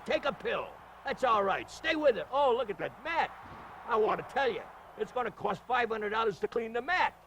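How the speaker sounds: normal talking voice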